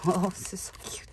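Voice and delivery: fast, laughing whisper; delighted whisper. Real, not acted. Crafter whispering over a cute embellishment, delighted whisper. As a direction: breathe it out in awe.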